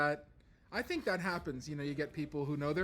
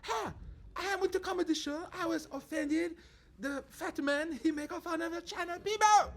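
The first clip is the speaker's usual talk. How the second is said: put-on high, loud Chinese-accented complaint; imagine her complaining in a Chinese accent; 10 semitones higher, 4 dB louder than usual. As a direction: pretend Chinese-accented grievance. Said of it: "it would have went something like".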